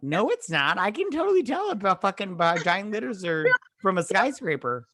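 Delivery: high, fast indignant retort; incredulous. Retold or imitated. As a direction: scoff at the claim. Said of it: "I'm like".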